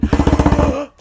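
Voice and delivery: loud sharp delighted gasp; excited gasp. Retold or imitated. Reunion with a friend, an excited gasp. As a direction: gasp at seeing an old friend.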